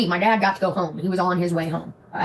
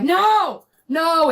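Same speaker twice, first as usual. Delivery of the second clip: panicked, high-pitched no; panicked high cry; 11 semitones higher, 4 dB louder than usual. Retold or imitated. Cop tailgating her.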